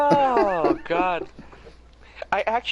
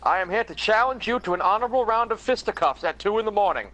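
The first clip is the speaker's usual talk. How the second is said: mock honorable knight; 9 semitones higher, 5 dB louder than usual.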